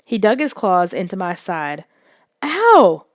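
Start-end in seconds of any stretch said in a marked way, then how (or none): 2.4-3.0 high, loud yelp; sudden pained ow; sharp yelp of pain. A narrator's reading. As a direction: yelp as claws dig in. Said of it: "I yelped".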